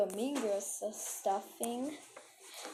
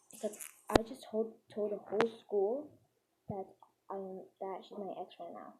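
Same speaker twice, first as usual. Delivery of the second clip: ordinary talk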